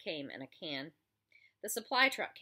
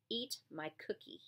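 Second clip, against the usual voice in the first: quiet menace; 2 semitones lower, 8 dB quieter than usual. Acted. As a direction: threaten under your breath.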